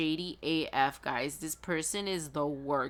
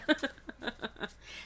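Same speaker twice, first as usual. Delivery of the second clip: high breath-led giggle; laugh; 7 semitones higher, 3 dB quieter than usual. Real, not acted. Reader done with the impression, giggling high. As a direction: crack up after your own impression.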